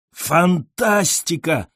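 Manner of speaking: high, stretched cry of amazement; wild yell. A narrator's reading. Admirer hails the poet's verses, a stretched wild cry. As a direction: exclaim wildly but without full volume. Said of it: "заорал я диким горлом".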